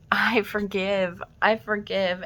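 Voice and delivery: an emotional, heartfelt plea; tearful. Retold or imitated. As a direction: let the forgiveness spill out, close to tears. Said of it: "And all I said was".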